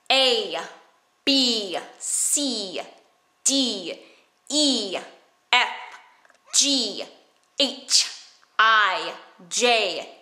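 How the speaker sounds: each letter said with exaggerated mouth movements; overdone alphabet. Shown as a demonstration. Teacher demonstrating overdoing the alphabet, exaggerated mouth. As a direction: stretch every letter with a huge mouth. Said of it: "overdo the alphabet".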